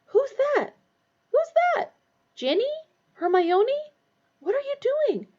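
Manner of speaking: put-on high, alarmed girl's voice; startled girl voice. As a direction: ask sharply into the dark. Voiced character in dark train.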